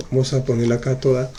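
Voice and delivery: ordinary talk, nothing marked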